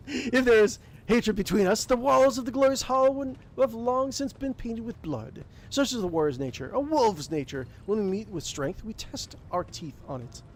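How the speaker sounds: a proud wolf warrior, amused; gruff warrior chuckling. Acted. Game master to players as Thorvald, jovial warrior voice.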